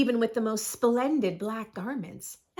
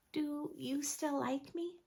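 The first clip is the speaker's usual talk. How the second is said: soft, higher, vulnerable voice; small and unsure, much softer; timid question; 5 semitones higher, 9 dB quieter than usual. Acted. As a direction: ask it shyly, fearing rejection. Vlad timidly asks after sharing secret.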